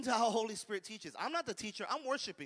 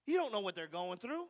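ordinary talk, nothing marked

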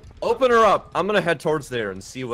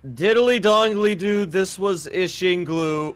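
higher, slow showman voice; slow announcer outro; 6 semitones higher, 3 dB louder, slower than usual. Pretend. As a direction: draw it out like a host signing off. Hosting the video outro.

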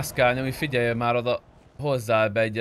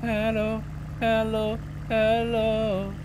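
repeated high sing-song hellos, well above his usual voice; calling out hello; 8 semitones higher, much slower than usual. Real, not acted. Checking whether anyone is on the line, calling out high sing-song hellos. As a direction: call out hello over and over in a high voice.

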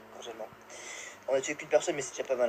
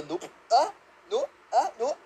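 ordinary talk, nothing marked

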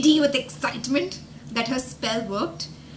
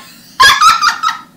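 very high, loud cackling laugh; wild laugh; 15 dB louder than usual. Retold or imitated. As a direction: laugh out of control. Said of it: "Toby laughed so hard".

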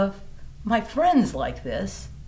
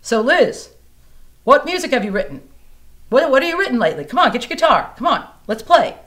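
much louder, higher urging voice; eager friend; 7 semitones higher, 11 dB louder, faster than usual. Retold or imitated. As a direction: push her to grab her guitar. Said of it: "he said".

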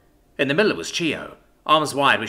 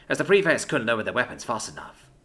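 usual speaking voice

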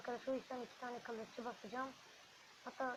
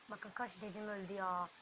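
ordinary talk, nothing marked

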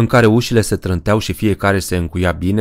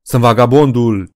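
louder, gleeful mock-menace; prankster's shout; 4 semitones higher, 5 dB louder than usual. Tom Dillon mocks the scared women, loudly. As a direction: boom the boast as a joke. Începu să râdă.

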